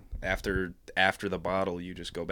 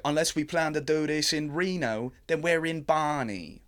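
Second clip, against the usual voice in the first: put-on Cockney accent; 8 semitones higher, 4 dB louder than usual. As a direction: lay the Cockney on thick. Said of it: "Cockney British".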